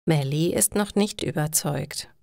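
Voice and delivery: ordinary delivery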